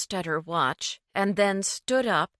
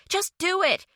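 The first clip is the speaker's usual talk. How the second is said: much higher, sharp order; barked command; 12 semitones higher, 4 dB louder than usual. A narrator's reading. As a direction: snap the order at him. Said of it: "she barked at him".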